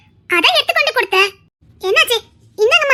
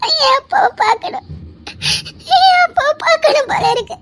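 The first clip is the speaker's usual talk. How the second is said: tearful longing; 6 semitones higher than usual. Acted. Woman longing for her family.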